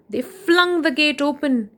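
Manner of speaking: ordinary delivery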